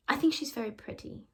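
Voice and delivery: put-on boy's voice. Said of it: "He says".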